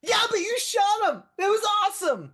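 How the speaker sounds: loud, very high gushing yell; excited fan. Acted. Fan insists to the modest hero, thrilled yelling. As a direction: overrule him with a thrilled shout.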